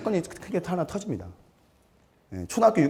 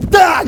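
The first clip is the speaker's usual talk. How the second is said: sharp high loud imitated whack; smack sound; 15 dB louder, much slower than usual. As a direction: snap out the crack of a stick. Imitating the stick hitting. (날카롭게 '딱' 하는 매 소리 흉내)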